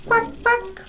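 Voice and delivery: high, soft duck quacks. Acted. Teacher showing children a duck, high soft quacks. Imitating the duck just named.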